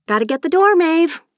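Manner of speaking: high, loud breezy call; hurried excuse. A narrator's reading. Charlotte breaks away from her friend's questions, hurried excuse. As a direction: toss it out as an escape.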